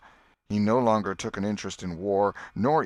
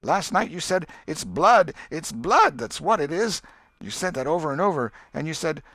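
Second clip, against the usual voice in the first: younger brother's voice pitched higher, pressing; a sly kid needling his brother about last night; Sid accusing Tom; 8 semitones higher, 5 dB louder than usual. Acted.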